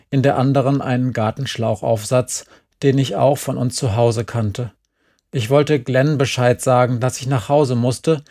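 ordinary delivery, nothing marked

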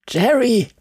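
high, urgent call of a name; hissed call. A narrator's reading. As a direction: call the name to get attention. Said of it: "zischte Glenn ein paar Mal".

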